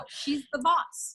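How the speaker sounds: usual speaking voice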